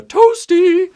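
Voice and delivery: high, loud sing-out; mock pop-up call. Person jumping in the way, mock high call. Mimics a game's pop-up shout.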